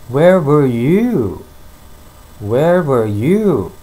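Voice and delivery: slow model reading. Shown as a demonstration. Teacher repeats model question slowly.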